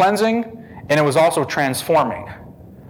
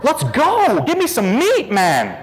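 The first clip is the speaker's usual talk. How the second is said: impatient mock plea; 7 semitones higher than usual. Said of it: "It was like".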